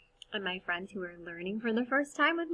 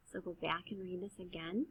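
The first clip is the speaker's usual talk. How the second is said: normal talking voice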